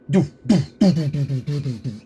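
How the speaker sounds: loud doof drum hits; imitating drum beat. Imitating the EastEnders drum ending. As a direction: beat out the theme with your voice.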